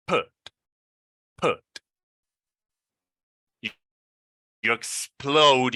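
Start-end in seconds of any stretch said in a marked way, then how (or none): none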